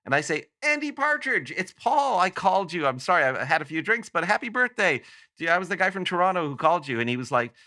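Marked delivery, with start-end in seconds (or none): 0.6-7.0 much higher, breathless excited blurting; excited fan. Retold or imitated. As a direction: rush it out, starstruck and apologetic. Fan corners idol in men's room.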